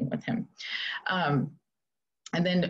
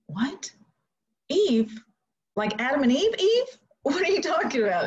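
high, loud disbelief; can't believe what she's hearing; shrill incredulous outburst; 10 semitones higher, 4 dB louder than usual. Retold or imitated. Baffled reaction to odd question.

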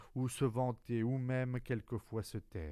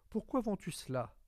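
put-on much higher, louder child's question; curious child; 11 semitones higher, 4 dB louder than usual. Little prince questioning the merchant, high and curious. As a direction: ask it like a puzzled child.

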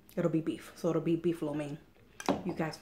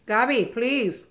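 loud, slow, exasperated; stern plea; 5 semitones higher, 10 dB louder, much slower than usual. Real, not acted. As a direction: drag out the scolding plea. Scolding child while filming.

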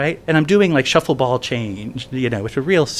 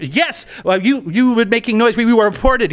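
insistent dorm manager; 8 semitones higher than usual. Retold or imitated.

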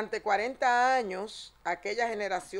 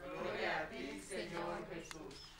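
cathedral echo; 3 semitones lower, 13 dB quieter than usual. Real, not acted. Congregation reply heard from afar.

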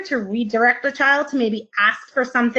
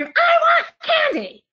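put-on kid screaming; slower than usual. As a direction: scream for candy like a small kid.

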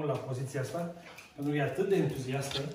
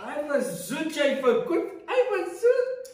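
high, loud, laughing through the words; delighted laughing; 9 semitones higher, 7 dB louder, slower than usual. Real, not acted. Trainer thrilled the dog finally did it, laughing high and loud. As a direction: burst out laughing with joy at the dog.

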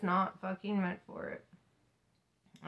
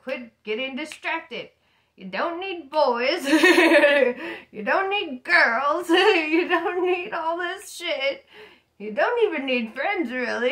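higher, louder, slow, laughing through the words; laughing God voice; 6 semitones higher, 9 dB louder than usual. Retold or imitated. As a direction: play God scolding her while cracking up. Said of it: "For God to be like".

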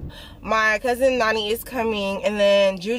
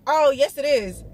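normal talking voice

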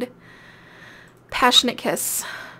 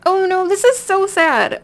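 high, loud mock wail; hammed-up sadness, higher and louder; playful mock grief over a game death; mock lament; 7 semitones higher, 7 dB louder than usual.

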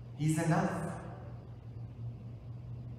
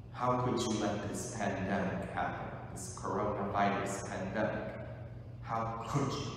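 hurt reproach; 5 semitones lower, 5 dB quieter than usual. Acted.

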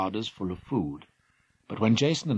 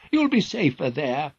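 urgent warning; 6 semitones higher, 5 dB louder than usual. Acted. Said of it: "cried King Phineus".

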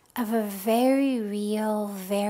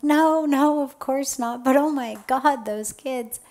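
upset protest; 3 semitones higher, 5 dB louder than usual. Retold or imitated. Student protests to the guru about the children, upset and louder. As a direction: blurt out your distress, then trail off. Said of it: "I'm like".

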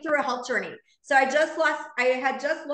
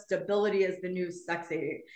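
lower, softer mock-serious Ben voice; a lightly voiced, knowing friend; imitating Ben; 6 semitones lower, 5 dB quieter than usual. Friend Ben pitching the platform, in a lower, softer mock-serious voice. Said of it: "He goes".

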